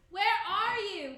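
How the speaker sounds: high, loud call across the moor. A narrator's reading. King calling for the witches on the moor. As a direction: call out into the mist. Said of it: "he called".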